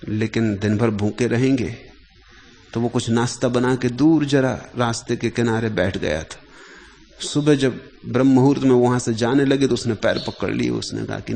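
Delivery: normal talking voice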